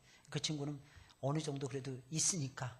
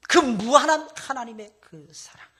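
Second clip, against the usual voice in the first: drawn-out loud high proclamation; slow soaring preaching; slow loud swell; 7 semitones higher, 14 dB louder, slower than usual. Acted. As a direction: stretch each word out loud.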